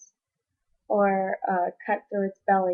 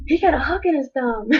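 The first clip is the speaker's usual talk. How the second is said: higher and louder, blurting the news; excited announcement; 6 semitones higher, 8 dB louder than usual. Retold or imitated. Telling her family about grandpa's hooked thumb, excitedly. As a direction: burst out with it, half laughing. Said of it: "And I'm like".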